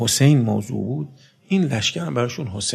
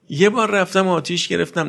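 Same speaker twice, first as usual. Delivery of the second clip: normal talking voice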